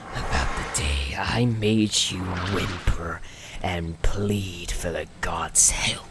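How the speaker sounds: ordinary talk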